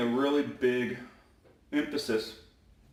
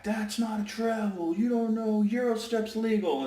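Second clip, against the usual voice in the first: complaining coach; 11 semitones higher than usual. Retold or imitated. Coach protesting a travel call, high mock protest.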